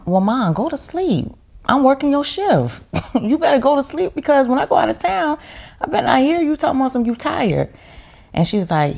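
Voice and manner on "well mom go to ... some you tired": high, fast, laughing mock scolding; teasing her tired mom to go to sleep, pitched high and laughing.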